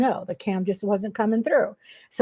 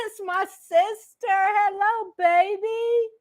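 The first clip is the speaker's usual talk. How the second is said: higher, delighted sweet greeting; excited; 10 semitones higher, slower than usual. Real, not acted. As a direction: gush over your sister. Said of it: "do you see how I am".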